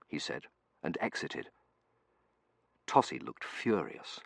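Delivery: ordinary delivery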